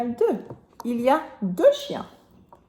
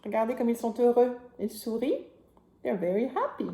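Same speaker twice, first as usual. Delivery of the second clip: put-on reading to a toddler; 4 semitones higher, 3 dB louder than usual. Reading picture book to child.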